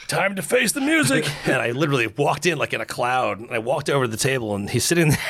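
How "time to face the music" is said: high, slow, drawn-out mock resignation; hammed-up grim resolve; mock dread. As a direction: say it like walking to your doom. Retold dread before facing chef.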